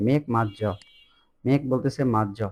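ordinary delivery

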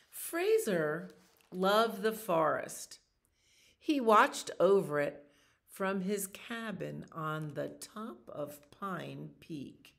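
animated picture-book narration; reading to children. A narrator's reading. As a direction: tell the story to little listeners. Reads picture book aloud.

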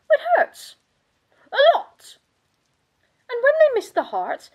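high, loud, outraged complaint; pained indignation. Acted. Countess telling of being staked by amateurs, pained and outraged. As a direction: cry it out, aggrieved and hurting.

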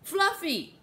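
put-on high little-child voice; prompt, eager suggestion. A narrator's reading. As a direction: blurt out the name straight away. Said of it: "Lainey said promptly".

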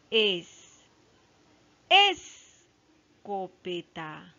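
wrongly stressed "Escopeta", weight on its first syllable. Shown as a demonstration. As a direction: hit ES, pause, then trail through co-pe-ta. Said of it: "No, ahí no está".

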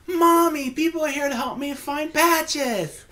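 put-on high little girl call to her mother. Little girl calling her mommy about helpers for Patches. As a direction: call out like an excited small child. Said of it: "this little girl goes".

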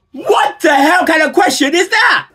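outraged shout. Real, not acted. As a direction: shout it in disbelief. Outraged at hypothetical question.

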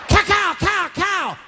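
high shouted pops of gunfire; gunfire imitation. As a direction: spit out cow cow like gunshots. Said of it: "that's gunfire".

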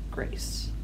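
breathy whispered Grace; whispered name. Shown as a demonstration. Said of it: "most importantly".

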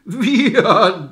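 wieherten whinnied out like a horse; high, loud horse neigh on the word. A narrator's reading. Village boys mock the old man, neighing.

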